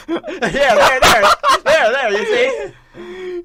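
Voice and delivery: high squealing words through laughter; laughing hard. Real, not acted. Cracking up at the Keemstar meme, squealing through laughter.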